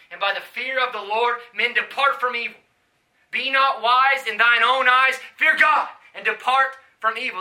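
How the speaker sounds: loud, high, driving sermon cadence; full-force preaching. Acted. Preacher warning the crowd about God, at full force. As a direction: preach it out hard to the street.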